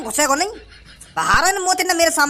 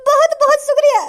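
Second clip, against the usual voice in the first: louder thanks; 9 semitones higher, 5 dB louder than usual. Acted. Beggar thanks rescuer, repeated louder.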